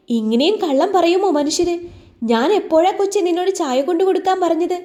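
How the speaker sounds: high, fast, indignant outcry; flustered protest. A narrator's reading. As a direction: protest the lie in a rushed high voice. Malathi caught out, flustered protest. (ഉയർന്ന സ്വരത്തിൽ വേഗത്തിൽ വെപ്രാളത്തോടെ)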